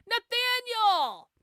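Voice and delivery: high, drawn-out call of the name; high, slow hail to the commenter. Real, not acted. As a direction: sing out the name, slowly.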